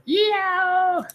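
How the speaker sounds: high, drawn-out comic howl; yelp of pain. Acted. Boy has stabbed himself in the face, pained yelp. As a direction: let out a long high "yeow".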